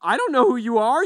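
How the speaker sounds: high, loud, rising confrontation with who stressed; loud outraged rising question; indignant retort. Retold or imitated. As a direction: demand to know who this stranger is. Retold confrontation with a thief.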